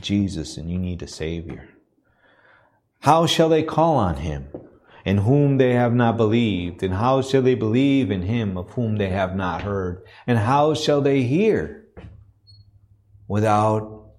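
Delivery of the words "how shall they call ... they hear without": lifted, emphatic pulpit reading of the verse; rising scripture preaching. Acted.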